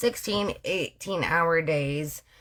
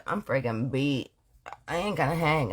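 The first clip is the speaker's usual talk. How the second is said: tired low husband; 5 semitones lower, 3 dB quieter than usual. Retold or imitated.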